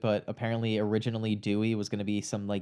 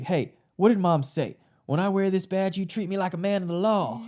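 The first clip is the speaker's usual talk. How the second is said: a light Southern twang, pitched up, quoting Dewey; 8 semitones higher than usual. Retold or imitated. Podcaster to cohost, quoting Dewey with a hint of Southern twang. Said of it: "a little bit of Southern twang when he delivers that line".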